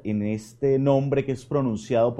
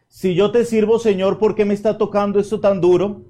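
loud, higher pleading complaint to the Lord; repeated loud "Si yo te sirvo" in a higher, aggrieved pitch; plaintive reclamation; 7 semitones higher, 7 dB louder than usual. Acted. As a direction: demand answers like a worn-out servant. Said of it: "Y el reclamo es".

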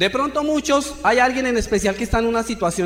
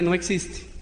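tearful murmur; 4 semitones lower, 6 dB quieter, slower than usual. Retold or imitated. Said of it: "Me fui llorando y dije".

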